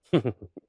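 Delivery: short burst of loud laughter. Real, not acted. Laughs at his own joke.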